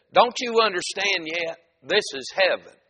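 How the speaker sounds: exasperated joke-teller's quote. Acted. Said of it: "Peter replied with some exasperation".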